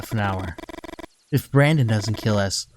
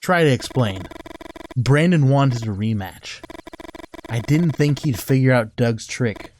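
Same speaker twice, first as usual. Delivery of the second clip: normal talking voice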